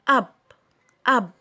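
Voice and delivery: أب as "up" with a short "uh". Shown as a demonstration. Teacher showing U's sound versus name, short vowel. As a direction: say أب twice, short vowel, not "yoo". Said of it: "هل الحرف ده اسمه أ ولا اسمه يو".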